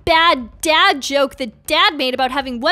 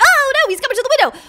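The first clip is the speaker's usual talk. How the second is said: high, rapid alarmed cry; mock panic; 10 semitones higher, 4 dB louder, much faster than usual. Player sees villain coming to the window, in mock panic. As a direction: panic fast and high, playfully.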